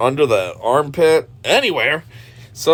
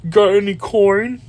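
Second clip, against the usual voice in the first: mocking impression of a creep's voice; imitating his voice; 6 semitones higher than usual. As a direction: mimic the creep's slimy tone. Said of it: "And then his voice".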